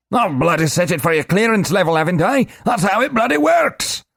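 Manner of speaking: high, loud sputtering rage; angry little man. Acted. Globin raging at Korash, high sputtering shout. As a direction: splutter out the curses.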